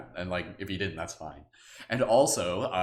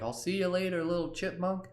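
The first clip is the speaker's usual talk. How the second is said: higher, tender farewell to a tiny creature; sweet goodbye; 11 semitones higher than usual. Pretend. Listener bids the chipmunk farewell in a higher, sweet voice. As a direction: wave off a little friend.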